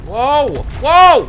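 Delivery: high, loud rising then falling "Whoa!"; real surprised loud whoa; startled whoa. Real, not acted. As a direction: cry out in shock. Something surprising happens.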